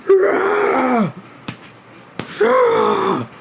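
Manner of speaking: two high, loud mock screams; exaggerated scream. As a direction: scream it like an over-the-top celebration. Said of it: "I ain't scream like that".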